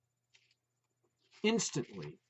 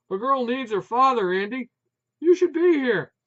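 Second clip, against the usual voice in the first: higher, louder, rising; reproachful; 9 semitones higher, 11 dB louder than usual. A narrator's reading. Wife reproaching Putz for being away, loud reproach. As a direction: plead the point.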